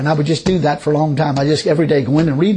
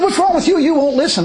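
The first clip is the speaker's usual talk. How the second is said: imitating an angry man, pitched way up; high mock-indignant retort; mad; 11 semitones higher than usual. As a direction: act out a flustered, mad objection. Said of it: "He got so mad".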